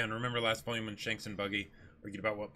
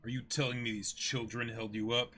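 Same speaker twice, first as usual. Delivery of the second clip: icy pirate captain, contemptuous; reading the manga aloud, cold pirate captain; villain captain Kuro; 3 semitones higher than usual. Acted. Reads Kuro's lines.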